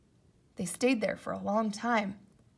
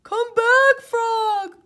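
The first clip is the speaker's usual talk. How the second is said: very high, loud pleading shout; desperate shout; 13 semitones higher, 14 dB louder, much slower than usual. Acted. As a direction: shout for Frog to return. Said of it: "he shouted".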